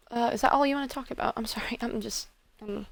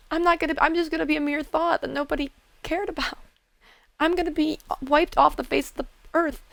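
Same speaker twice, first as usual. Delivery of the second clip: higher and louder, voice breaking into tears; emotional outburst; 7 semitones higher, 6 dB louder than usual. Acted. God of death fears being forgotten, tearful high outburst. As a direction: spiral into a tearful panic about vanishing. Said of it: "I'm sorry, I'm getting emotional".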